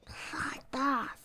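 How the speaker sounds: under the breath, voiceless; whispered swear. Real, not acted. Streamer whispering a curse at the game. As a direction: hiss it under your breath.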